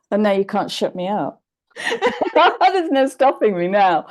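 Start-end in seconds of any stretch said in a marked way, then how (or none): 1.8-4.1 laughing, higher and louder; bubbling with laughter. Real, not acted. Laughing about not shutting up.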